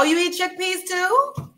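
ordinary talk

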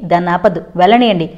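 ordinary delivery, nothing marked

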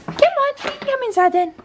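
very high, coaxing dog voice; high-pitched cooing invitation. Real, not acted. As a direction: coax a dog in, squeaky and high. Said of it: "baby talk your dogs".

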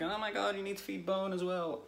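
high-pitched mocking whine of a scolding commenter; mock outraged viewer. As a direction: parody a nagging viewer, way up high. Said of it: "before anyone starts shouting like".

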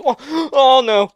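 gasp, then cry out; startled alarm. Real, not acted. Gamer startled by a new creature, alarmed cry. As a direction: gasp and loud, high cry.

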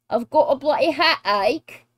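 playful mock British accent. Showing off the accent she loves, mock British. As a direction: put on a hammed-up British accent. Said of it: "I love the British accent".

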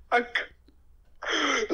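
high laughing splutter. Real, not acted. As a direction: laugh while you refuse. Reacts to a shocking offer.

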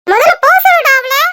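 mother shouts at her son to send her away; angry shout. Acted. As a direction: bark the command at the son.

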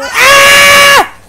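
loud, high yell of fright; startled scream. Real, not acted. Gamer caught off guard by a fright, screaming. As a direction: scream as if jumped.

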